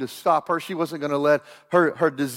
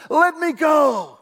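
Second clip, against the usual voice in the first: high, loud, drawn-out command; angel's demand; 9 semitones higher, 5 dB louder, much slower than usual. Retold or imitated. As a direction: cry out slowly to be released. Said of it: "the angel of the Lord says".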